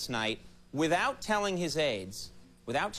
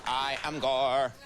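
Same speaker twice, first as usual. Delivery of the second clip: slow, lower, deliberate politician voice; slow, stiff impression of a politician; Gore; 4 semitones lower, slower than usual. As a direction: say it ponderously, dragging each word. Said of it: "that's it for my Gore impression".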